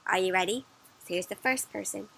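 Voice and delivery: ordinary delivery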